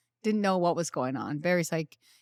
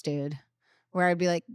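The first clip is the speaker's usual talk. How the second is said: ordinary talk, nothing marked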